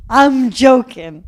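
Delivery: loud, slow insistence; emphatic reassurance. Real, not acted. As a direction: stretch out 'I'm joking', loud and slow. Insists joke after mock rant.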